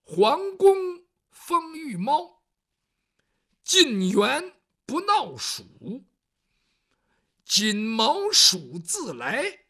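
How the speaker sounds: slow, loud declaimed verse; poem recitation. Acted. Storyteller reciting the wall poem, slow and declaimed. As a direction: declaim each line slowly. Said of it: "这诗上是这么写的".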